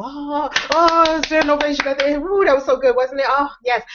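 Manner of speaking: high, bright cheer after the show; delighted cheering. Pretend. Guest to friend after the show, high delighted cheering. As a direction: swoon over the finale with high cheers.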